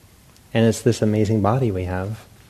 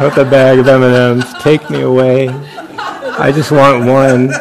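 higher, much louder, slow laughing plea; mock yearning plea; 3 semitones higher, 10 dB louder, slower than usual. Meditating monk pleading with the M and M's, mock yearning. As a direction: beg the candy bag to rescue you.

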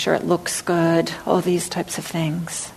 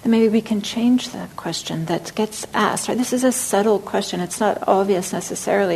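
normal talking voice

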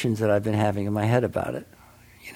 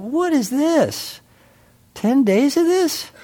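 much higher, slow, drawn-out outrage; exasperated disbelief; 13 semitones higher, slower than usual. Retold or imitated. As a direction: sound trapped and fed up. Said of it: "I thought".

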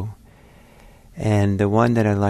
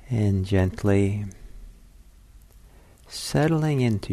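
guided meditation. Acted. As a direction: talk them gently into stillness.

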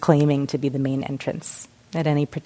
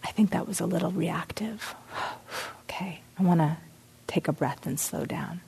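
soft whispered self-check, a sigh in the middle; gentle inner whisper; 10 dB quieter than usual. Acted. A calm inner voice noticing it was reactive, whispered gently. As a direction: whisper it kindly to yourself, catching your breath. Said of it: "there's a voice that says".

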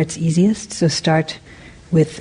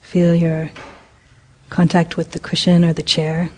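guided meditation. Acted. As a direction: ease the group into stillness, unhurried. Leads a metta meditation.

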